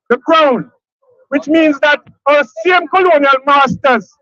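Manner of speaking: emphatic, repeated rally oratory; rousing rally speech. Acted. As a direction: drive each phrase home to the crowd.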